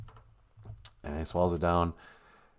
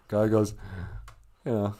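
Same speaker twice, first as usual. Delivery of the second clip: ordinary talk, nothing marked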